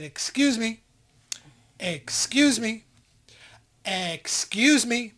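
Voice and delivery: drawn-out, pointed excuse me; insistent excuse me. Retold or imitated. Train rider asking a woman blocking the doorway, insistent.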